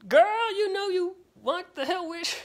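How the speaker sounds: very high-pitched playful put-on voice; jokey high-pitched egging-on; silly high voice. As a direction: squeal it in a mock sassy falsetto. Putting on a silly hype voice.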